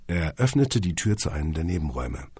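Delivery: usual speaking voice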